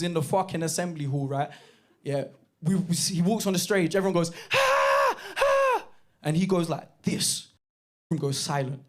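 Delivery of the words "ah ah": very high mock crowd scream. Whole assembly hall screaming at the star on stage. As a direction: squeal like a starstruck school crowd.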